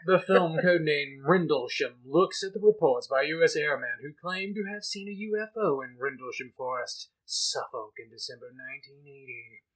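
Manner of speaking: loud newsreader voice; news read. Acted. Reads the BBC article aloud.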